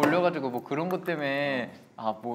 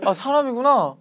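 startled realization; 12 semitones higher, 8 dB louder than usual. Real, not acted. Realizing the mannequin is human.

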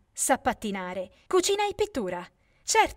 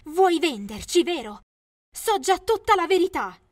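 high, loud accusation; a daughter accusing her mother of selling her; 6 semitones higher, 6 dB louder than usual. Acted. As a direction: call her out, sharp and high.

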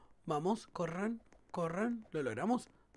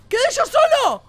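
high, loud incredulous yelp; shocked disbelief; 8 semitones higher, 6 dB louder than usual. Real, not acted. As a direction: yelp it, stunned at being left alone. Finds that teammates all died. (grito de incredulidad)

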